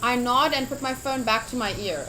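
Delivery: ordinary delivery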